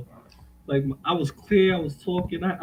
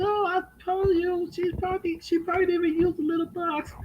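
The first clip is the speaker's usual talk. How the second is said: imitating wife; 13 semitones higher, 5 dB quieter than usual. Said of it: "She's like".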